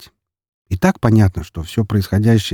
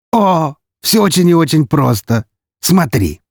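gleeful; 8 semitones higher than usual. Acted. Count gleefully introduces his game.

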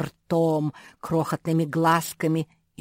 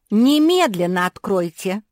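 loud, higher, insistent little Labrador; demanding; 4 semitones higher, 9 dB louder than usual. A narrator's reading. Little Labrador to whoever locked the door, loudly demanding. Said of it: "потребовала маленькая лабрадориха".

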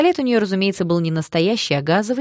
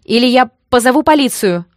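loud, higher-pitched threat; indignant; 7 semitones higher, 9 dB louder than usual. A narrator's reading. Girl threatens thugs bothering her friend, indignant shout. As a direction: warn them off in outrage. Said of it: "возмутилась я".

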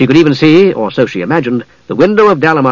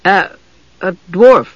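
halting, higher-voiced amendment; awkwardly hesitant, pitched up; lame finish; 6 semitones higher, slower than usual. A narrator's reading. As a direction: stumble into a feeble finish. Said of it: "she finished lamely".

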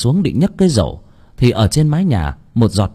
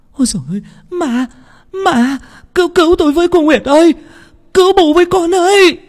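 panicked scream climbing ever higher and louder; screaming for help; 5 dB louder, faster than usual. A narrator's reading. Woman sees a severed head and shrieks for Nguyệt, building panic. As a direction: start high in fear and build to a desperate, fast shriek. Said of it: "Bởi lẽ chị hét to lắm".

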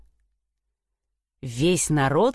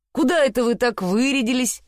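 higher-pitched, lightly voiced nosy question; curious simpleton brother; 5 semitones higher than usual. A narrator's reading. Youngest brother asks his dressed-up brothers, curious. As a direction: ask as the storybook simpleton, pitched up. Ганс спрашивает нарядных братьев.

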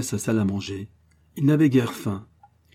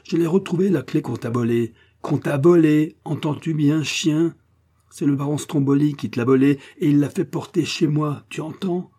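higher, louder enraged tirade; furious; 6 semitones higher, 4 dB louder than usual. A narrator's reading. Prince berating Antonovitch over the key, furious. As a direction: berate him, barely holding the rage. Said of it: "bégayant de fureur".

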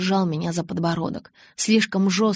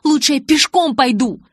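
loud, high, indignant retort; angry refusal; 7 semitones higher, 8 dB louder than usual. A narrator's reading. Bella would rather walk than ride with Jacob, indignant. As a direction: refuse hotly, voice raised. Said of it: "прошипела я".

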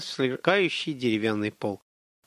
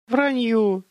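high, playful rebuttal; cheerful objection; 9 semitones higher, 3 dB louder than usual. A narrator's reading. Boy teasingly contradicts girl about her lack of feelings, cheerfully. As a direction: cheerfully call it nonsense, pitched up. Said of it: "возразил он весело".

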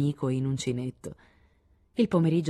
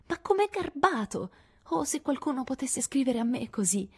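molto acuta, sognante e incantata; ammirata; 8 semitones higher than usual. A narrator's reading. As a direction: sospira d'ammirazione. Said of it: "disse Amy". (sorella minore incantata dalla lettera, voce molto acuta)

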